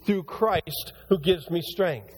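slow, weighty verse quote; a reverent, deliberate recital; slow verse reading. Shown as a demonstration. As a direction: lay each word of the verse down slowly. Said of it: "quotes the verse slowly".

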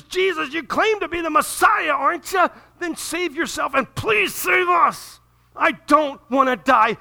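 high, loud panicked shout; desperate cry. Acted. Dying thief crying out to Jesus, terrified shout. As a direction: scream it like a man terrified to die.

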